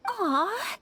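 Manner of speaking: high, gushing happy thanks; overjoyed. Acted. Lady touched by new friends, gushing happy thanks. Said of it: "I'm forgetting myself".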